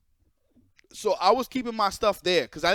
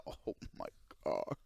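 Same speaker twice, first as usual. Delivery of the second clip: breathless whispered oh my God; seeing the cops arrive, whispered dread; whispered dismay; 16 dB quieter than usual. Real, not acted.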